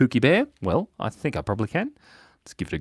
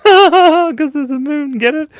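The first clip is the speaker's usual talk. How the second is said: high and loud, laughing through the pun; giggly pun delivery; laughing at own joke; 9 dB louder, slower than usual. Real, not acted. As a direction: crack up at your own joke.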